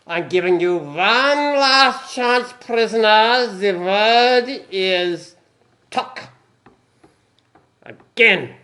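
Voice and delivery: put-on loud, very slow German accent threat; angry German accent. Commandant threatening the prisoner, loud German accent. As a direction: bellow a slow menacing warning in a German accent. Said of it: "heard you do an accent".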